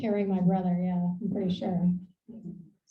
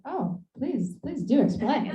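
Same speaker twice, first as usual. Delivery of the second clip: higher, laughing, teasingly intrigued; mock eager; 4 semitones higher, slower than usual. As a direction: egg him on with a laugh. Mock reply to criminals/outlaws quote.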